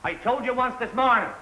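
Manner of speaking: high, loud reproach; angry scolding. Acted. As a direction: shout it at a boy who won't listen. Scolding the boy from afar.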